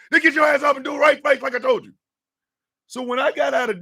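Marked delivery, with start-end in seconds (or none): 0.1-1.9 loud, high, rapid-fire chewing out; fast shouted drill sergeant reprimand. Retold or imitated. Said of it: "Drill Sergeant, I know".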